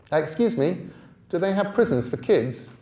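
put-on boy fearing prison; worried boy. A narrator's reading. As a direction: ask nervously from the back.

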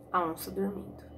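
ordinary delivery

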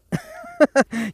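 a squeaky high burst of laughter; high giggle. Real, not acted. Painter laughing at losing his paint to Oscar.

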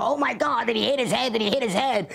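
mock-panic cry over a fallen man; imitating a panicked yell. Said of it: "one guy's yelling out".

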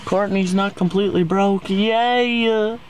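high, sing-song fake excitement; sarcastic cheer. Sarcastic cheer about money.

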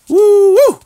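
high loud whooping call; high, loud mock trumpet woo. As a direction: whoop like a war trumpet sounding. Trumpet battle cry imitation.

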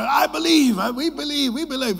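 high, loud crowd roar of belief; crowd shouting. Retold or imitated. Preacher voicing the circus crowd shouting belief. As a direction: shout it back like an eager crowd. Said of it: "Everybody shouted".

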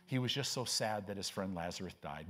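low, soft, offhand mock sympathy; mock casual reading. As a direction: imitate a reader glossing over the verse. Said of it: "we read that scripture".

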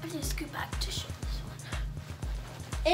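quick whisper; hushed, hurried aside. Real, not acted. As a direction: whisper it hurriedly under your breath. Hushed aside while moving back.

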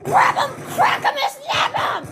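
real hyped-up yelling; fired-up shout. Real, not acted. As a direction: egg on the fighter at full voice. Urging on his fighter.

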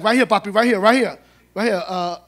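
loud, laughing calls to stop the car; Spanish tossed in, loud and amused; calling out to the driver. Retold or imitated. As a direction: shout directions at the cab driver with a grin. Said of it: "that's all the Spanish I know".

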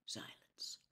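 ordinary delivery

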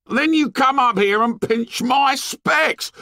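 put-on very high, outraged boy; angry accusation. A narrator's reading. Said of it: "Piggy lost his temper".

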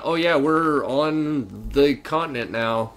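slow, drawn-out mock announcement; deadpan imitation of game dialogue; mock game character.

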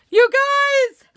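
high, loud burst of excitement; bursting to tell the viewers her box arrived; excited call. Real, not acted. Host greets viewers in a high, loud excited call.

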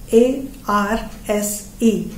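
"A R S E" spelled letter by letter, slowly; slow letter-by-letter spelling. Shown as a demonstration. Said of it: "the English spelling of".

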